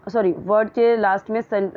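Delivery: normal talking voice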